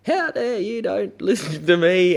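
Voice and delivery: very high, slow squeaky bark; hammed-up cracking sergeant. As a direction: overplay the sergeant's breaking voice. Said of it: "increased the performance".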